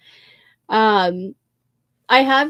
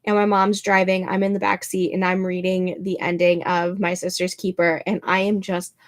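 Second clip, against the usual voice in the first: ordinary talk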